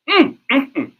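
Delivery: high, loud emphatic hums; emphatic humming; loud mm-hmm. Real, not acted. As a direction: hum in strong agreement. Reacting to result.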